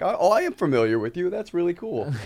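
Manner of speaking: high, put-on friendly chattiness; feigned interest. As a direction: humor a supposed celebrity with loud fake interest. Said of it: "feigning interest".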